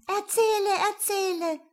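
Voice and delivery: put-on high, excited children's plea; children calling. A narrator's reading. Children clapping and begging the man, high eager calling. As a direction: clap and beg like small children. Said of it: "die Kinder klatschten in die Hände und riefen".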